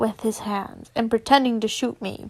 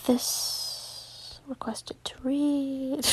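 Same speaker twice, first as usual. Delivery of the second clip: slow secretive whisper; 8 dB quieter, much slower than usual. Real, not acted. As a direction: breathe it like a secret. Secret aside to the audience.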